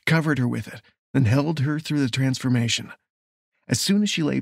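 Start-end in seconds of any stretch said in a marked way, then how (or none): none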